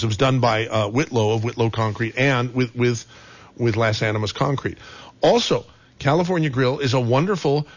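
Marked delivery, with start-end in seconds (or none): none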